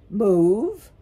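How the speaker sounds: loud, rising incredulous question; incredulous owl. A narrator's reading. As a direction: fire it back in disbelief. Owl reacts to request to move.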